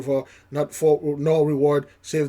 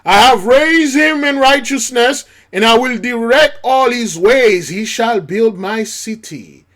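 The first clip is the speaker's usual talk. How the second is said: very loud, high proclamation; full-force preaching; 9 semitones higher, 11 dB louder than usual. Acted. Preacher thundering scripture at congregation, very loud. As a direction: thunder the verse out.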